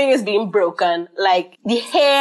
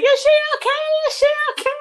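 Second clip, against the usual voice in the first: much higher, anxious repeated question; frantic worry; much faster than usual. Retold or imitated. New mother anxiously asking about her baby, high. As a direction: ask in a panic about the baby.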